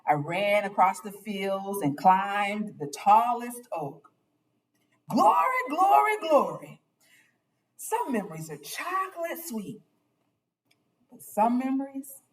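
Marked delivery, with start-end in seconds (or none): 5.1-6.8 high, loud glory shout; rejoicing shout of glory; joyful cry. Acted. Joyful exclamation in narration.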